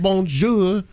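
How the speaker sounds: drawn-out tourist French bonjour; earnest French accent, slowed. Retold or imitated. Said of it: "I will try the accent".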